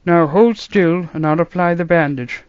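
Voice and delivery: put-on musing old man, baffled; puzzled grandfather voice; old doctor voice. A narrator's reading. Doctor bandaging.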